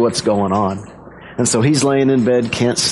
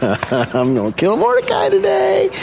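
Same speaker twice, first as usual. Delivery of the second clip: high, slow, gloating mock glee; hammed-up villain savoring his plan; gleeful villain. Haman gloating as he heads to the king's palace, excited. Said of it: "he's all excited".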